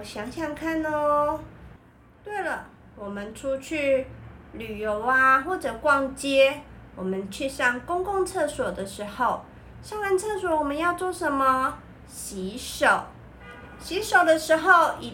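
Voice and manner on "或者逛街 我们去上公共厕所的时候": ordinary delivery, nothing marked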